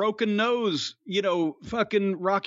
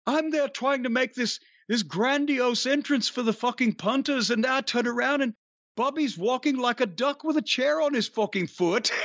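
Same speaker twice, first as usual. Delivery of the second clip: higher-pitched imitation of Adrian's English accent, told for laughs; 6 semitones higher than usual. Retelling Adrian's ring entrance story, in a light imitation of Adrian's English accent. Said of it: "in an Adrian's accent".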